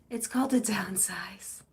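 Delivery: hushed whisper; whispered aside. Real, not acted. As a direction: whisper the title.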